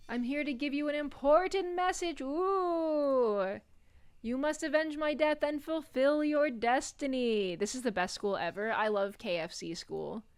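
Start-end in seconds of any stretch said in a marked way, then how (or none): 0.1-7.5 overacted death scene. Dying ghost delivering prophecy.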